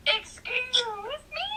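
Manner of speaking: high, indignant excuse me. Retold or imitated. Reader offended by the hero, high indignant excuse me. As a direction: act offended at the insult. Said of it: "I'm like".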